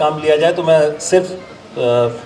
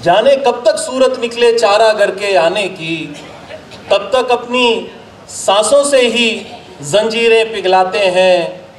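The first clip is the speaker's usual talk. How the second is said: measured, declaimed Urdu couplets with pauses; ghazal recitation; 8 semitones higher, slower than usual. Acted. Poet presenting a ghazal to an appreciative gathering, declaimed recitation. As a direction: recite verse to a mushaira audience, line by line.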